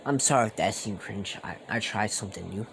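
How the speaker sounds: usual speaking voice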